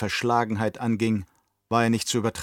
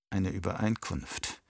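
softer, lower, weighing "Eine Übereinkunft"; inner repetition; 3 semitones lower, 8 dB quieter than usual. Acted. Nephew turning over the uncle's word, softer and lower. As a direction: murmur "Eine Übereinkunft" as a private thought. Feyd repeats it in thought.

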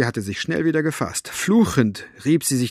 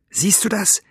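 high, tense hiss; 9 semitones higher than usual. A narrator's reading. Elinor to Maggie, watching the two men, hissing. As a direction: hiss it as an indignant aside. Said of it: "zischte Elinor".

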